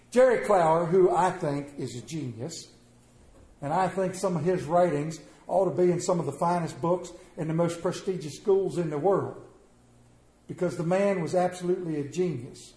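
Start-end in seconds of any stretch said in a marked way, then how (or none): none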